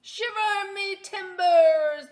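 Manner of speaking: slow, loud, high pirate exclamation. Acted. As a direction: ham up the old salt. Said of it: "the pirate says".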